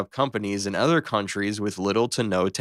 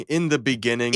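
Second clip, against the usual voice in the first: normal talking voice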